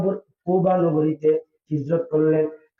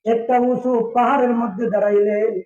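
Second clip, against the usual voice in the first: high, ringing chanted narration; melodic preaching; 8 semitones higher than usual. A narrator's reading. Preacher telling of Bilal climbing to give azan, high chanted preaching. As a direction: open high and chant through the phrase.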